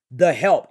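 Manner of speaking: THE HELP set off loudly in air quotes; air-quoted emphasis. Real, not acted. Host quoting a label she rejects, air-quoted emphasis. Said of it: "air quotes".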